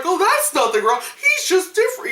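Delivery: high, put-on dismissive voice; naive character. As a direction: brush off danger in mock innocence. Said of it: "and they're like".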